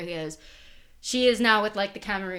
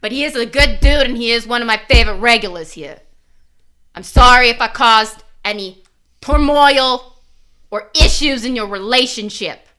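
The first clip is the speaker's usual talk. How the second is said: insistent; 2 semitones higher, 7 dB louder than usual. Pretend. Player character insisting to Sandy, loud and earnest. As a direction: press the apology hard.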